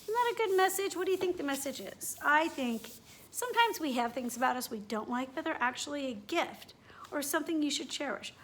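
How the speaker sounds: ordinary delivery, nothing marked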